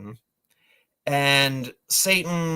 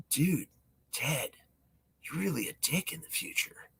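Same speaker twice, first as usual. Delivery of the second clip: hushed aside; 15 dB quieter than usual. Retold or imitated. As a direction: drop to a soft, hushed murmur. Whispered aside between dudes.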